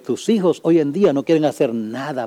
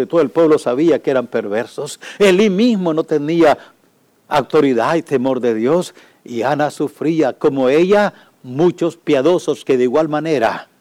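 normal talking voice

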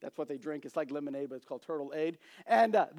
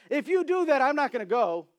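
loud, higher indignant outburst; indignant shout; 8 semitones higher, 7 dB louder than usual. Acted. Hidden turtle shouts from the bushes, indignant. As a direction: call out caught them.

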